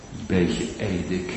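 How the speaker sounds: normal talking voice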